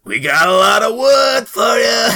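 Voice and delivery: high, loud hollering shout; calling out. Acted. Lumberjack hollers a crude joke to the crowd, loud. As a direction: yell it across the camp.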